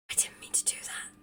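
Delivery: hushed, whispered oops; whispered dismay. Real, not acted. Player realising a mistake in the game, whispered dismay.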